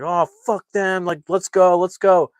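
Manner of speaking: fed up, rushing them out; mad, impatient. Retold or imitated. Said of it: "it makes him really mad".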